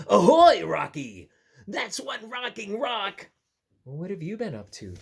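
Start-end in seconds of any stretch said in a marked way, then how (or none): 0.1-3.3 loud hello, then a playful pun; cheerful Narwhal greeting. Acted. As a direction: greet the new friend with a grin.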